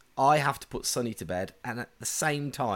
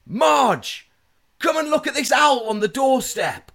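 high, loud yell to Marge; hammed-up calling out; 13 semitones higher, 8 dB louder than usual. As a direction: holler the punchline across the house. Dad performs his joke's punchline.

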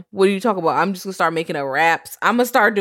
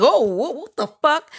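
shocked; 10 semitones higher, 4 dB louder than usual. Retold or imitated. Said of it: "I'm like".

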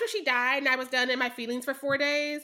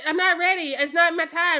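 louder, higher dying-woman quote; mock protest; 5 semitones higher, 6 dB louder than usual. Retelling a dying character's plea, loudly protesting. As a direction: imitate her refusing to go, raised voice. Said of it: "'Cause she's like".

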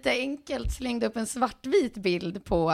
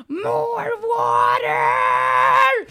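piercing high, very loud shriek; child's scream; 14 semitones higher, 9 dB louder, much slower than usual. Retold or imitated. Child yelling at a parent cooking macaroni, screaming. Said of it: "så skriker hon bara så här".

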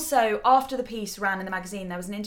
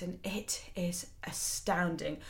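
awed whisper; 2 semitones lower, 8 dB quieter, slower than usual. Real, not acted. Awed aside about the extract.

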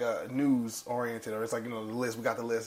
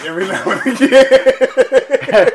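high, loud laughing squeal; cracking up; 11 semitones higher, 16 dB louder, slower than usual. Real, not acted. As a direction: lose it laughing on the words. Cracking up at his own joke.

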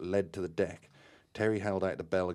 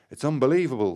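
loud, much higher emotional outburst; full of emotion; 7 semitones higher, 10 dB louder, faster than usual. A narrator's reading. Terry returning shaken, full of emotion. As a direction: burst out, overwhelmed. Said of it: "his voice full of emotion".

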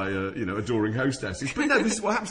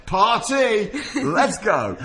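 loud, higher mock excitement; fake excited spotting; 10 semitones higher, 7 dB louder than usual. Pointing out [name] to distract [name], hammed-up and loud. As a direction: sell the fake celebrity sighting loudly.

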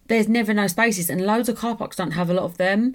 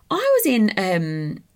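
usual speaking voice

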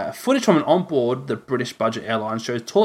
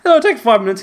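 high, loud mocking echo of a friend; mocking imitation; 10 semitones higher, 8 dB louder than usual. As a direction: throw his words back in a squeaky voice. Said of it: "he says".

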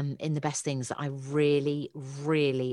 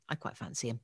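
ordinary talk, nothing marked

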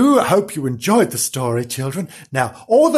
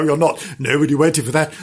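ordinary delivery

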